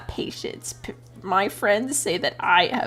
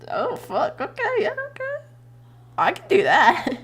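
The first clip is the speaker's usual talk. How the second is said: mock giddy relief; 12 semitones higher, 3 dB louder than usual. Retold relief at easy resume trick.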